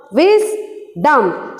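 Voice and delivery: "Wisdom" in two beats, a clear pause after Wis; split into syllables. Shown as a demonstration. Teacher showing the class two syllables, "Wisdom" split Wis-dom. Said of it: "So these were the two syllables".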